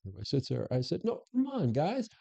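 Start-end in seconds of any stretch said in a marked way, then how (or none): 1.0-2.1 exasperated coaxing. Retold or imitated. Coaxing silent officers at town hall.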